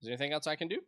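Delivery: normal talking voice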